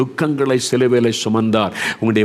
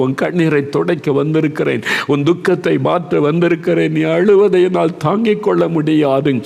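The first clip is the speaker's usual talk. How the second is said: fervent preaching; 4 semitones higher than usual. Acted. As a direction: rouse the congregation with rising fervour. Preaching at full force.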